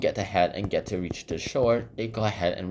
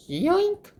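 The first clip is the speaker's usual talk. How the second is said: tiny squeak of a cartoon shrink; shrill cartoon yoink; high squeaky yoink. Sound effect for shrinking.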